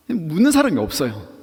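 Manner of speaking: ordinary talk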